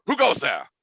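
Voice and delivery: high, loud mock-gallant demand; mouse leader's challenge. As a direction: call out like a tiny musketeer on guard. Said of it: "and he's".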